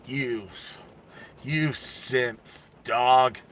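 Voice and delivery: quiet, low, halting insult; low menace. Acted. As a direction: let each insult drop softly, with menace. Roger's menacing insult before drawing gun.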